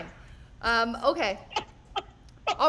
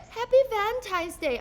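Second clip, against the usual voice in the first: put-on child's voice; 8 semitones higher than usual. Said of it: "four-year-old said".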